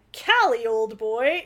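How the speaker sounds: grand theatrical announcement; pompous actor voice. Acted. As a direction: boom the name like a stage actor. Introducing a pompous actor character.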